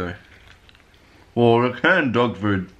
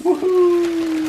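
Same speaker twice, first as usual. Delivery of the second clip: woohoo cheer; 4 dB louder than usual. Real, not acted. Both cheering birthday.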